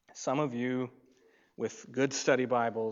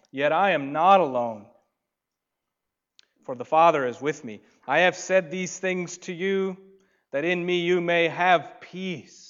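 loud, raised proclamation; proclaiming; 3 semitones higher, 8 dB louder than usual. Acted. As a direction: declare it with force. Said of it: "quotes Jesus".

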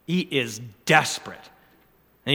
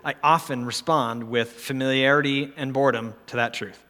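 usual speaking voice

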